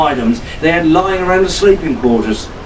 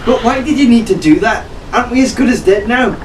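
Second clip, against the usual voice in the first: alarmed; 7 semitones higher, faster than usual. A narrator's reading. Arabella alarmed in the storm.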